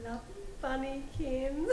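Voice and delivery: put-on giggling baby talk to a lover; giggly late night call. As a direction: coo it through a giggle. Lovey-dovey phone goodbye.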